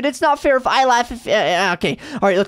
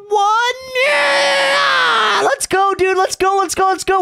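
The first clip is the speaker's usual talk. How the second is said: triumphant yell, much higher; excited shout; 9 semitones higher, slower than usual. Real, not acted. Valve turned, celebrating.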